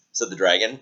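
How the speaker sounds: ordinary delivery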